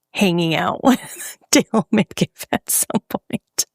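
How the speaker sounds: breaking into a breathy laugh; words squeezed out through laughter. Real, not acted. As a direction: say it while giggling helplessly. Laughs while voicing a wish.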